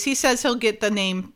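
usual speaking voice

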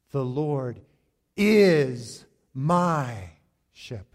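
"is" punched loud and long, "my" set apart; emphatic "is my". Shown as a demonstration. Pastor leading congregation to read along, strong stress on "is my". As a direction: hit "is" hard, stretch "my", let "shepherd" drop. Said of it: "emphasize that as you read it".

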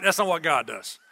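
ordinary talk

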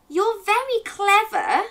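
butter up the neighbour in a high voice; lifted, drawn-out storybook flattery; flattering wolf. A narrator's reading. Wolf flatters neighbour before asking favour.

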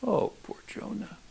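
mock-pitying whispered aside; whispered pretend pity for Jonah. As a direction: breathe it like teasing sympathy.

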